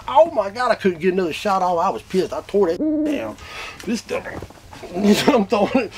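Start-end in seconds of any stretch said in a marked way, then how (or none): 0.1-1.8 frustrated outburst. Retold or imitated. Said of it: "And I'm like".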